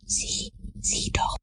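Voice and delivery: slow, soft whisper; urgent whisper. A narrator's reading. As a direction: whisper to your friend to look. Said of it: "flüsterte Tom".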